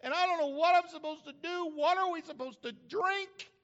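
high-pitched, mock pleading wail; crying out. Moses crying out to God about grumblers, hammed-up plea. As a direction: plead upward in an exaggerated high voice. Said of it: "He cried out to God".

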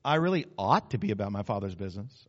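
low, dutiful admission; unemphatic, half-hearted. Shown as a demonstration. Preacher showing weak resolve, low half-hearted admission. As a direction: concede it without conviction. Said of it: "Or maybe it's like this".

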